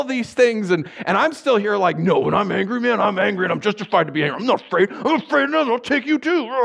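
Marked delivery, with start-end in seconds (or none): 2.0-6.5 high, chest-pounding mock rage building to a roar; angry bravado. Preacher acting out his protest at being called afraid, mock angry bravado. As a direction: puff up into over-the-top defiance. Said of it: "cuts through all the bravado, all the chest pounding".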